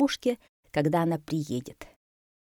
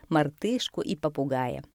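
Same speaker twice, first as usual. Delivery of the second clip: usual speaking voice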